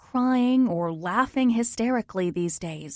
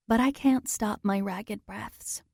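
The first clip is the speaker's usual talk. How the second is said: ordinary delivery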